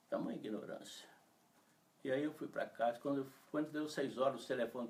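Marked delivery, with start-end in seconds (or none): 0.1-1.1 quiet echo. Real, not acted. Repete a frase baixinho, lamentando.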